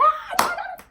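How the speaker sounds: very high-pitched mock holler; squawked, hammed-up outburst; mimicked shouting. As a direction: imitate someone shouting over everyone. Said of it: "And he was shouting".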